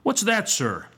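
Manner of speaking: high-pitched, stern schoolmaster question; indignant questioning of a pupil; growled demand. A narrator's reading. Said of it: "growled the schoolmaster".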